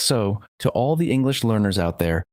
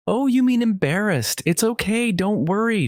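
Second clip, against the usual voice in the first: reassuring giggles; 12 semitones higher than usual. A narrator's reading. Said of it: "she reassured me between giggles".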